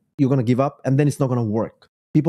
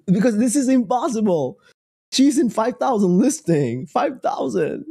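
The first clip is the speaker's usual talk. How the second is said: incredulous venting; 6 semitones higher than usual. Real, not acted. Venting about a rival's listing count.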